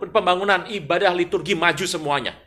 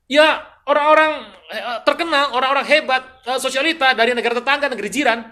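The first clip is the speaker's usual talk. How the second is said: high, loud, self-important answer; acted boasting king; 9 semitones higher, 8 dB louder than usual. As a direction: show off your famous guests, hesitating on the list. Acts king boasting of guests. (lagak raja pamer, lantang)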